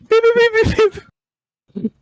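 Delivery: high electronic beeps; robotic beeping. Pretend. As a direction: beep like a scanner. Robot scanning sound effect.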